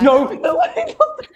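high laughing no; laughing protest. Real, not acted. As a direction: squeal it out laughing.

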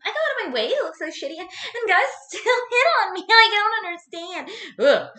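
hammed-up bratty diva voice; mock vain whine. Shown as a demonstration. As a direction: play a conceited girl complaining she's too pretty. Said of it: "that sounded, like, too, like, full of myself".

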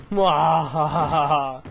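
slow evil mwahaha laugh; mad scientist. Pretend. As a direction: cackle like a villain, slowly. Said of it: "I'm so evil".

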